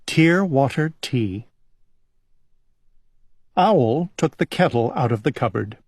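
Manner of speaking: ordinary delivery, nothing marked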